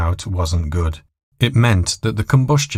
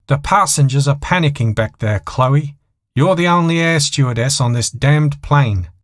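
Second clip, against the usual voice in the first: higher, sharp scolding; angry pilot; 7 semitones higher than usual. A narrator's reading. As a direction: berate her from the cockpit. Pilot berating stewardess.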